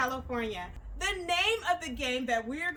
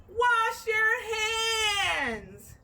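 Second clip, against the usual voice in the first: long, drawn-out shouted call, higher; called-out command; 5 semitones higher, much slower than usual. Shown as a demonstration. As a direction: belt it out like a coach calling a drill. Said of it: "you're gonna hear me say".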